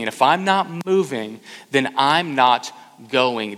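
usual speaking voice

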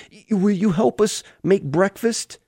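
put-on small child asking a parent innocently; sweet innocent child. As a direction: put on a sweet little kid's voice. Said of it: "in this sweet little innocent voice".

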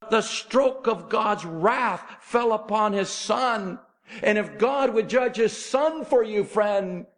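high, urgent pulpit delivery; high-pitched, emphatic preaching; preaching at full force. Acted. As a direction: press each phrase on the congregation like a revivalist.

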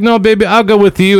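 lightly voiced coaxing vendor; sweet vendor. Vendor coaxing the tourist, high sweet voice. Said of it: "She's like".

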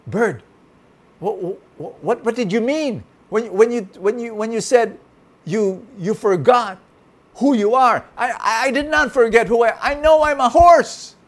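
high, loud, stammering horse; flustered horse. A narrator's reading. Horse stammers his question and denial to the bird. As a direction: sputter defensively. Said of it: "And the horse said".

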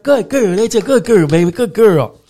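high, loud puppy praise; retold excited praise; praising the puppy. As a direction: gush over a good dog. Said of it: "I was like".

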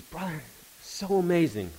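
high-pitched, gushing imitation; excited friend. Friend texting news of an answered prayer, excited. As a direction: act out an amazed friend's message.

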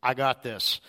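lightly voiced, low-pitched worker; tree lot worker. Worker turning down an offer of help, lightly voiced low. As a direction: drop the pitch a touch for a self-assured worker.

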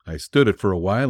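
ordinary delivery

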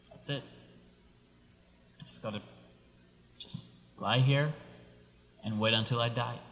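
soft, low, halting resignation; giving up hope. Acted. Stranded traveler giving up in the desert, softly resigned. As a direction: murmur it with long pauses, all hope gone. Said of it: "He's given up all his hope".

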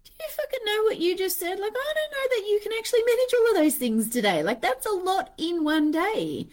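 can't believe what you just heard; incredulous husband. Retold or imitated. Husband reacting to her ten-thing list, incredulous. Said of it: "his face looking at me like".

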